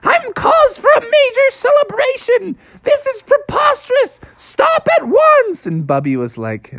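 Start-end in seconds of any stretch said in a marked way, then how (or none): 0.0-5.6 very high, outraged squeal; Punxsutawney Phil. Acted. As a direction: protest frantically as the hunted groundhog. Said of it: "Punxsutawney's like".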